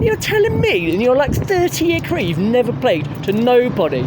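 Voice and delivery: high, worked-up scoffing; indignant rant. Real, not acted. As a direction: scoff at the claim in disbelief. Ranting at singer's claim.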